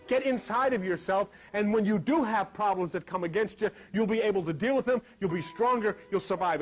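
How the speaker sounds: ordinary delivery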